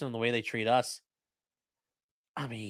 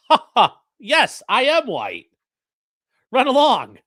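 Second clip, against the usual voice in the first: loud, high, slow smug gloating; hammed-up condescending "run along"; smug mock laugh; 7 semitones higher, 9 dB louder, slower than usual. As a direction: lord it over them with a haughty laugh.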